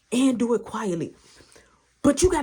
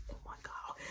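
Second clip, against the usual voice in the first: very soft whispered exclamation; retold panicked whisper; hushed dismay; 21 dB quieter than usual. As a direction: whisper in dread of being caught. Hiding, dismayed by the noise.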